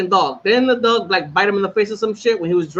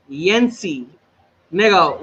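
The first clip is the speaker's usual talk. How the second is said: ordinary talk, nothing marked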